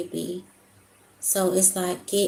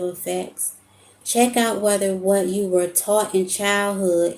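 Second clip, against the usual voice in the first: louder, deliberate read-aloud voice; reading a message aloud; 6 dB louder than usual. Acted. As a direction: read it out firmly, each phrase projected. Reads out a message.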